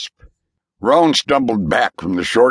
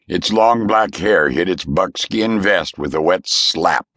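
ordinary delivery, nothing marked

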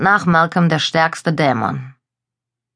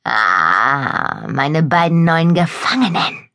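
vampire lord; much slower than usual. Acted. Vampire viceroy greets captives.

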